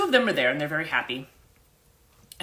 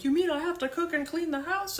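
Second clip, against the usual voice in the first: much higher, put-on voice of a spoiled complainer; mock indignant whine. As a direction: whine it like someone outraged at having to do housework. Mocking spoiled people's complaint.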